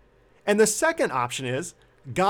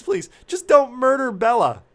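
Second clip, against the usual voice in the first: higher, louder, desperate begging; desperate plea; 6 semitones higher, 6 dB louder than usual. Retold or imitated. Husband begging his wife to spare the dog, desperate. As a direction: beg her to spare the dog. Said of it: "With tears in my eyes, I say".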